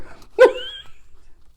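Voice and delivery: very high squeal of laughter; real high, helpless giggling. Real, not acted. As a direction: laugh till it goes squeaky. Keeps laughing at her joke.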